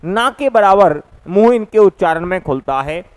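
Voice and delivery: ordinary talk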